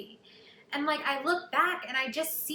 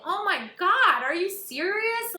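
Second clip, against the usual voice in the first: higher and louder, slow amazed question; overjoyed disbelief; 8 semitones higher, 6 dB louder, slower than usual. Retold or imitated. Kelly to her agents on the phone, thrilled. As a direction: gasp it out in joy. Reacting to Broadway booking.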